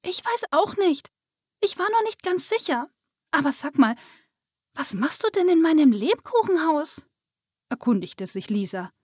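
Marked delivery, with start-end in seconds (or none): 0.0-7.0 put-on high-pitched, curious child; little girl. A narrator's reading. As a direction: voice a small girl, unsure then curious. Narrator voices little girl Lisa. (hohe, neugierige Mädchenstimme)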